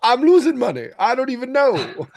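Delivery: very high-pitched character impression; exaggerated high voice, played for laughs; Baby Billy. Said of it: "the Baby Billy voice".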